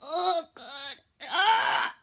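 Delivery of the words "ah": loud high yelp; sharp yelp. Real, not acted. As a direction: cry out at the sour taste. Reacting to sour candy.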